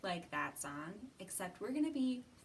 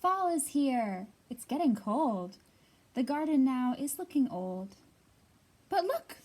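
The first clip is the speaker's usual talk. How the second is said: louder, lively storybook read-aloud; reading to children; 3 semitones higher, 10 dB louder, slower than usual. Acted. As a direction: read it out big and bright for a circle of kids. Reads picture book aloud.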